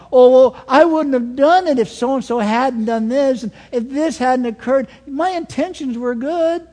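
high, pleading mock self-justification; hammed-up wheedling defence; mock excuse-making. Voicing excuses made to God.

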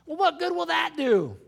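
a doubter scoffing at the cross; mock doubter. Voicing a doubter.

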